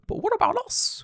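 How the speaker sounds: high, whiny mock plea; mock victim voice. Hiroshima pleading to visitors in a high mock whine. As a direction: play the wounded party in a squeaky high voice. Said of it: "Hiroshima is like".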